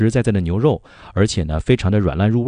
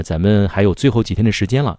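ordinary delivery

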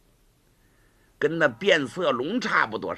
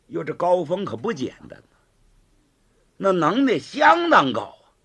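plain reading voice

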